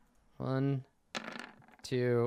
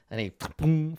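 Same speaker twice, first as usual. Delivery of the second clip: usual speaking voice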